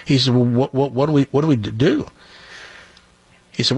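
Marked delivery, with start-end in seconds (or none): 0.3-2.1 heavy stutter, repeating first sounds. Acted. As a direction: stammer through every word. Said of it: "This guy has a stuttering problem".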